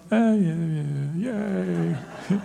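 shrugging, fading mock yay; lukewarm answer. Partner answers "do you love me" with mock indifference. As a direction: answer like you barely care.

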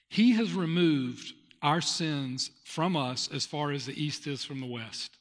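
slow, hushed reading of the verse; scripture reading. Acted. Pastor reading Psalm to his congregation, slow and reverent. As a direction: let the verse land slowly.

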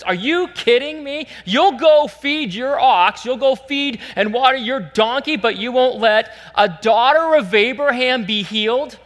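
high, loud indignant rebuke, acted out; really ticked off. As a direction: hammer out each accusation in outrage. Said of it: "Jesus got really ticked off".

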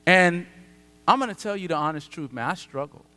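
ordinary delivery

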